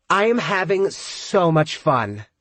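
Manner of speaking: high, slurred party voice; drunk and giddy. A narrator's reading. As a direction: gush drunkenly over the phone. Drunk friend on the phone.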